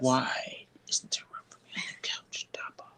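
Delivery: whispered imagined voice of the dog; whispered pet complaint. Pretend. Dog asks for room on the couch, whispered complaint. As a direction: plead in a hushed whisper as the pet.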